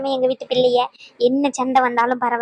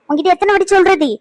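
angry shout; 7 semitones higher, 5 dB louder than usual. Acted. Scolding the child, peak.

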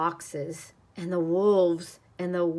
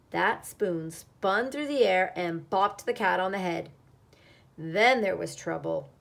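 plain reading voice

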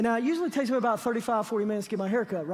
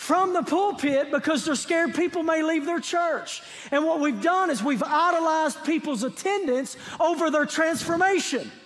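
preaching at full force; 4 semitones higher than usual. Acted. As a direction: fire up the church, urgent and rousing. Sermon climax at full force.

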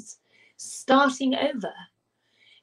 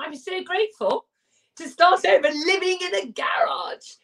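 grateful; 7 semitones higher than usual. Real, not acted. Grateful relief recalled.

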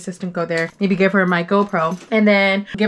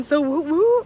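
ordinary talk

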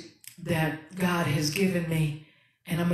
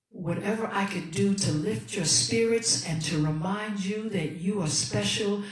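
normal talking voice

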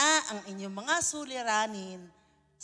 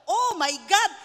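oh my God thrown out loud in alarm; mock shocked cry; 5 semitones higher, 9 dB louder, slower than usual. Pastor reliving the death threat call, startled. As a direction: replay the jolt of fright.

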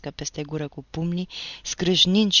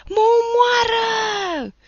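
far higher, loud and slow shriek; terrified howl; 10 dB louder, much slower than usual. Acted. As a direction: howl that he's being killed. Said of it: "urla Nicolae cu un glas înnebunit de groază". (urlet înnebunit de groază, ascuțit)

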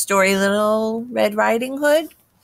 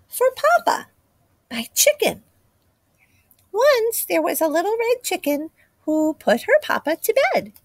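put-on child voice; 7 semitones higher than usual. Chick tells her own story.

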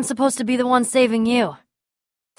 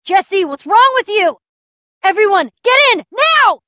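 high, rising yell to the group; urgent shouted orders; 6 semitones higher, 3 dB louder than usual. Acted. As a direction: shout commands in an emergency, building to the last word. Ordering everyone during an emergency.

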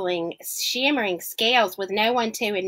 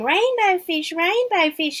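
higher voice of a little fish; small fish calling; 8 semitones higher, 4 dB louder than usual. Acted. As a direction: call after him eagerly. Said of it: "he called".

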